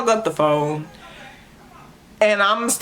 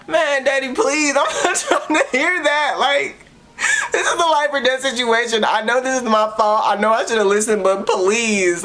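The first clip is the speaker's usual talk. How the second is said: much higher, half-laughing desperate begging; laughing plea; 8 semitones higher than usual. Retold or imitated. Daughter begging her dad for a ride, higher and half-laughing. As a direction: plead with dad, laughing at herself.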